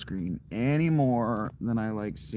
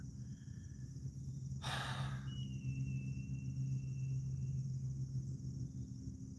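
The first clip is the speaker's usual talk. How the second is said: long breathy sigh; 7 semitones lower, 13 dB quieter than usual. Real, not acted. Changing his base layer, a long weary sigh.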